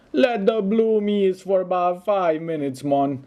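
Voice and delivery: high, hammed-up Jamaican lilt; horrible Jamaican accent. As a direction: put on a deliberately bad Jamaican accent for a laugh. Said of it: "That's my Jamaican accent, I know it's horrible".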